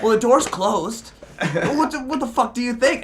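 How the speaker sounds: high, loud imitation of an indignant man; aggressive mimicry. Stranger snaps at him over the closed door, aggressive mimicry. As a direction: act out his irate, incredulous comeback. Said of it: "just being so aggressive".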